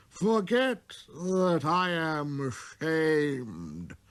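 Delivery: put-on very slow, sorrowful drunk; head hung, confessing shame; ashamed confession. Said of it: "the tippler confessed".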